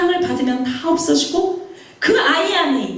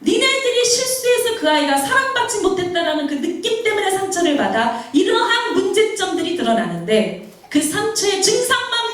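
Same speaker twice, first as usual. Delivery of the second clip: high, emphatic reprimand; stern divine scolding, pitched up; God's thundering rebuke; 6 semitones higher than usual. Acted. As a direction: rebuke a parent as God would, pressing each phrase. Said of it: "막 호통하는 게 느껴져".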